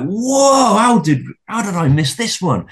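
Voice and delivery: whoa leaps up high, then an astonished question; amazed whoa. Retold or imitated. Recalling his first discovery of Tartaria, high amazed whoa. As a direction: gasp of discovery, wide-eyed and wondering.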